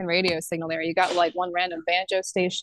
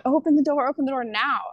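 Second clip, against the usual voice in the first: mock stern attendant demanding; imitating the flight attendant; 7 semitones higher, faster than usual. Retelling how the flight attendant ordered her out. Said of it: "She go".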